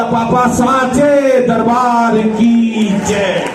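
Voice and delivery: stretched slow and loud, like a temple chant; drawn-out proclamation. Acted. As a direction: proudly chant it out slowly. Punchline, child's proud announcement. (धीमा, खिंचा हुआ जयकारा)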